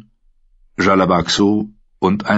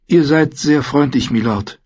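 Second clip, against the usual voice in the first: dejected; 7 semitones higher than usual. A narrator's reading. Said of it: "erwiderte sie niedergeschlagen".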